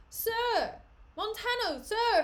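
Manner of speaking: high, loud calls; calling out. Acted. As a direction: shout across the fight. Iago calling for help.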